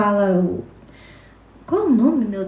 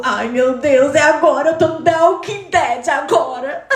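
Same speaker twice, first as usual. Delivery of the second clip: laughing panic; 3 semitones higher, 3 dB louder than usual. Retold or imitated. Panicking when she sees the zombie.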